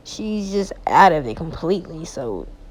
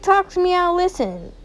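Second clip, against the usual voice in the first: high, sing-song mock sympathy; sugary mock concern; mocking family; 10 semitones higher, 3 dB louder than usual. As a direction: imitate the fake caring family, pitched up. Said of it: "her family's like".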